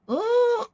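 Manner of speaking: high, drawn-out "mmm" of relish; long yummy hum; savoring hum. Real, not acted. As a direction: hum high as if tasting something delicious. Savoring talk of blueberry muffins.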